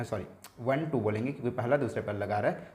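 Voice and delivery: ordinary talk, nothing marked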